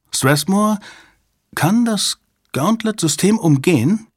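high, halting, uneasy question; ominous undertone. A narrator's reading. Mitch seizing on Jabba's remark, ominous undertone. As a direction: ask it high, pausing after the name, with foreboding. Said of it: "hatte ihre Stimme einen unguten Beiklang".

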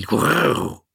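cat growl. A narrator's reading.

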